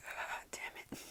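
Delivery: whispered curse under the breath; imitated breath-only exasperation; defeated frustration. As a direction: groan it out very softly, giving up. Acting out her defeated frustration.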